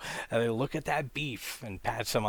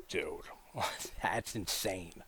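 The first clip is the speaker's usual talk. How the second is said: whisper; 2 semitones lower, 7 dB quieter, slower than usual. Real, not acted. Appalled aside.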